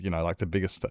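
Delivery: ordinary talk, nothing marked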